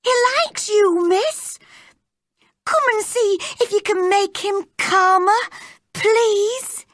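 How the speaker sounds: high, urgent pleading; frantic servant girl's high voice; distressed cry. A narrator's reading. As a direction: beg for help in a panic. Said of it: "she cried".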